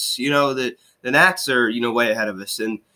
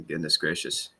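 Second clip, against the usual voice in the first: exasperated sigh; 7 semitones lower, 10 dB quieter than usual. Real, not acted. Exasperated at closer's walks.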